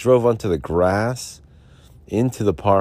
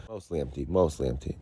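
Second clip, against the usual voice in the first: softer, muttering it to himself; muttered softly; 3 semitones lower, 8 dB quieter than usual. Shown as a demonstration. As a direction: drop the voice, mutter it. Repeats phrase, then drops voice.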